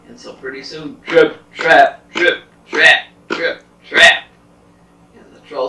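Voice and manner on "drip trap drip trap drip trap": loud, stomping hoofbeats; heavy trip-trap steps. Acted. Big goat stomps over the bridge, loud heavy steps. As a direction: pound out each word separately, forcefully.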